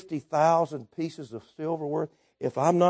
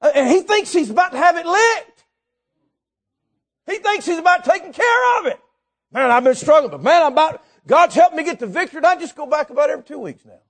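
high, raised incredulous preacher voice; full-force preaching; 12 semitones higher, 7 dB louder than usual. Real, not acted. Preacher mocking a man who thinks he has it licked, full-force preaching. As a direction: preach it loud, mocking the excuse.

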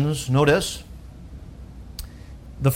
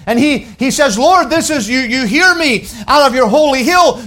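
rising, louder preaching cadence; 7 semitones higher, 4 dB louder than usual. Acted. Pastor to congregation, building preaching voice. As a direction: lift it up like a pulpit preacher.

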